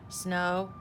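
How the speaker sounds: usual speaking voice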